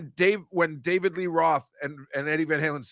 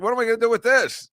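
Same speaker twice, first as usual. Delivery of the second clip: higher, faster puzzled mock voice; baffled mock; 10 semitones higher, 5 dB louder, faster than usual. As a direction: toss it off as a bewildered joke. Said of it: "she's like".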